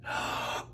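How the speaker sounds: quick gasp of breath; sharp inhale. Shown as a demonstration. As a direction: gasp in and hold. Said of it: "When you inhale ... lock the air inside".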